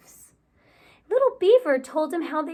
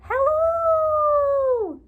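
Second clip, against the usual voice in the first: echoed call; 13 semitones higher, 4 dB louder than usual. Acted. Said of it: "the voice across the pond said".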